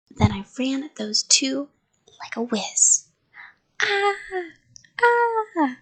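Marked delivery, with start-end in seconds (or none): 3.8-5.8 drawn-out, high-pitched "ah" shrieks; high screech. A narrator's reading. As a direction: screech to scare someone, playfully acted. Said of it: "I screeched".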